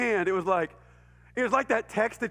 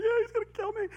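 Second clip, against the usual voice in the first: panicked sobbing reply; 14 semitones higher than usual. Retold or imitated. Crying boy answers neighbors.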